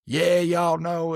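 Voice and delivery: slow country drawl; lazy, drawn-out country accent; straight country accent. Shown as a demonstration. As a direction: lean into a slow Southern country twang. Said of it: "just straight country".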